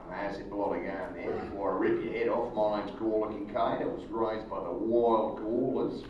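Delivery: low, soft tough-guy Australian; stock Australian accent. Acted. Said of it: "if you play in an Australian".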